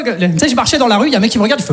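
ordinary talk

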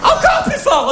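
hammed-up squealing lover.

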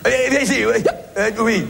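a hint of the colleague's nagging call; imitating Alain. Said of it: "j'adore l'imiter".

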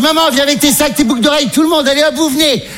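high-pitched rallying call; urgent hurrying. Retold or imitated. Son calls mother to bring her bags, high urgent hurrying. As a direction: herd everyone along, voice pitched right up.

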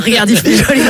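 normal talking voice

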